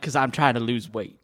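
usual speaking voice

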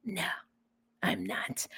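whispered aside. Real, not acted. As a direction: breathe it as a secret. Jokingly denies being mad.

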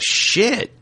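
shot up high, a mock near-miss yelp; retold startled curse. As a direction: imitate your own panic on the road. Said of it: "And I was like".

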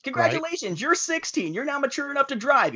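high, sing-song bureaucrat announcement; mock official voice. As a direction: parody a cheery government clerk. Mocking voice of the law.

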